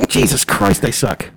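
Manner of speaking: ordinary delivery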